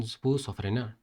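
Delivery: ordinary delivery, nothing marked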